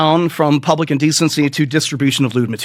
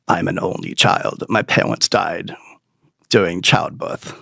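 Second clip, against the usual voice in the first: low, soft deadpan therapist; 7 semitones lower, 4 dB quieter than usual. Retold or imitated. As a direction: lightly voice the therapist's sad confession. Therapist voiced in story.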